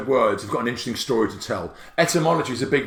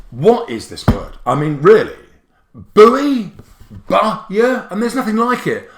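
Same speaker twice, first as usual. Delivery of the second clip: loud, high disbelief at a silly word; exasperated; 7 semitones higher, 7 dB louder than usual. Real, not acted. Teacher baffled by the word buy, loud exasperation. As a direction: throw up your hands at the spelling.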